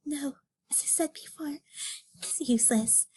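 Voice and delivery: whispered, resigned no; whispered refusal. Acted. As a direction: breathe the refusal out. Judy refuses quietly.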